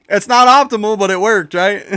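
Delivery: high loud laughing boast; excited shout. Real, not acted. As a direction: gloat happily at the win. Celebrating narrow win.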